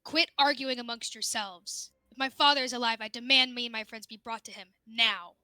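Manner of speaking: loud, firm command to the bickering elves; commanding demand. Acted. Exiled daughter ordering the arguing elves to take her to her father, loudly. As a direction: order them to stop arguing.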